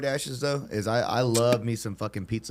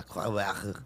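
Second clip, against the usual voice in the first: put-on French Canadian accent; 6 dB quieter than usual. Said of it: "French Canadians".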